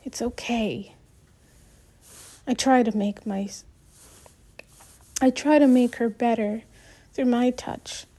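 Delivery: plain reading voice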